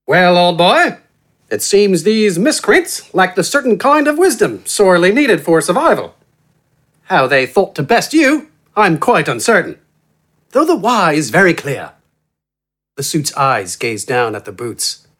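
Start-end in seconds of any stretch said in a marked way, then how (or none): 0.0-11.9 relaxed, silky gentleman, pitched higher; eloquent, friendly. A narrator's reading. Well-dressed man addressing the giant, eloquent and friendly. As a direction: let it flow with polished, genial ease. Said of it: "He talked in an eloquent, relaxed, and friendly voice".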